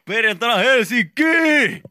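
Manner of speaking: very high, loud, stretched cheer; excited shout. Retold or imitated. Repeating own old Facebook post for laughs, excited shout. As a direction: whoop it out high and drawn-out.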